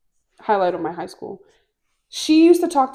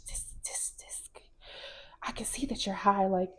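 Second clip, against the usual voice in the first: hushed, disapproving whisper; whispered scolding; 12 dB quieter than usual. Retold or imitated. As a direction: imitate a secretive telling-off under the breath. Hallucinated girl scolds the high student.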